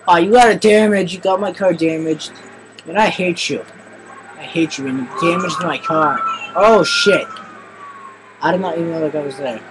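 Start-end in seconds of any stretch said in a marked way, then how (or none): 0.1-7.3 loud high whiny complaining; mock angry whine. Real, not acted. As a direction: scold the friend for the damage. Friend damaged his car.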